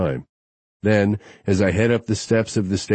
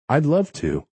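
eager female voice; 8 semitones higher than usual. A narrator's reading. Josie eager answer.

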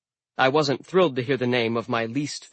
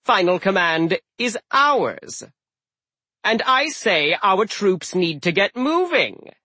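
strained patience; 7 semitones higher, 4 dB louder than usual. Acted. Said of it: "with strained patience".

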